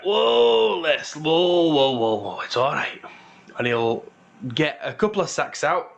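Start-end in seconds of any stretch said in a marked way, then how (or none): 0.0-3.0 calm a restless animal, easing down; soothing the ox. Acted. Dwarf steadies his stirring ox, drawn-out whoas falling to reassurance. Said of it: "the ox stirs a little bit".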